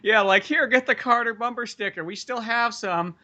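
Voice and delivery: high-pitched mock old-timer voice; hammed-up high old person voice; old campaign booth folks. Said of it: "old people sitting there".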